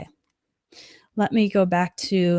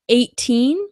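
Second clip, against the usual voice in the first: ordinary delivery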